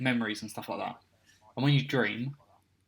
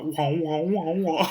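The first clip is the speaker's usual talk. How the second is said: imitating a choppy, drowning mic; garbled wah noises; 5 semitones higher, 5 dB louder, much slower than usual. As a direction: wah wah like a broken playback. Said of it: "he was going like".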